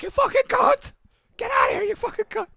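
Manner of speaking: very high strained mock yell; imitated scream. Imitating a comic screaming at crowd talkers, mock yell. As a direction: shriek it as a hammed-up tirade. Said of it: "He'd scream at the crowd".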